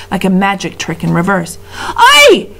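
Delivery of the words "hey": high, loud outraged yell; shout. A narrator's reading. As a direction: bellow at the fleeing rat. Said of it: "shouted the prisoner".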